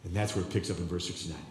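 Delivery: usual speaking voice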